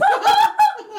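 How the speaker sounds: a very high, loud squealing laugh; real squeal of grossed-out laughter; shrieking laugh. Real, not acted. Disgusted reaction to poop story.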